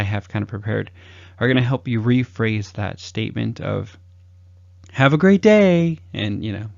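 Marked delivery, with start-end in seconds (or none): 4.9-6.0 higher, louder, slow and overdone; hammed-up stock farewell; sing-song cliché. Coach mocking a farewell that puts people to sleep, overdone. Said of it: "fall asleep".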